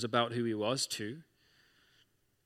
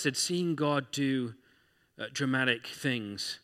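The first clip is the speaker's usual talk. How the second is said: usual speaking voice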